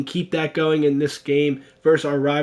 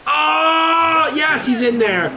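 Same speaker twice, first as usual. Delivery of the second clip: excited shout; 9 semitones higher, much slower than usual. Real, not acted. Home run call while playing.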